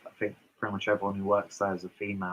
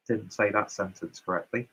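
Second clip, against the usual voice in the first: ordinary delivery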